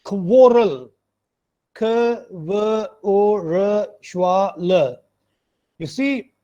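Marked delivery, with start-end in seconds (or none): 0.0-0.9 "quarrel" as KWOR-ul, rounded o; correct pronunciation of quarrel. Shown as a demonstration. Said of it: "this is quarrel". 1.7-5.0 slow separate sounds: kuh, wuh, o, ruh, schwa, luh; sound-by-sound breakdown. Shown as a demonstration. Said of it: "which is pronounced as quarrel".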